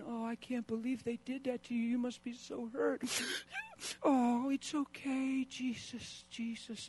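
high, slow, weepy pity voice; hammed-up sobbing sympathy; mock sentimental comforter. Pastor mocking a gushing comforter, high weepy pity. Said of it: "I'm not really interested in saying".

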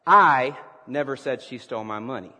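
"I" hit loud, then a pause; stress on I. Shown as a demonstration. Preacher showing the congregation how emphasis works, stress on "I". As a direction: punch the word "I" hard. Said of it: "So let's practice".

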